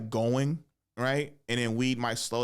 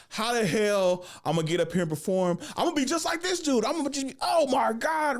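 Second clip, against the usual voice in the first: high-pitched, acted panic; freaking out; 12 semitones higher than usual. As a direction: voice climbs into a comic freak-out. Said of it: "freaking the fuck out".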